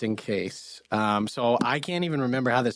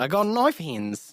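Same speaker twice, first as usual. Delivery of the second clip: put-on slow Kiwi accent echo; 7 semitones higher, slower than usual. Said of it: "stuck in, like, the Kiwi accent".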